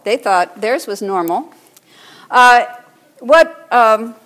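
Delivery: ordinary talk, nothing marked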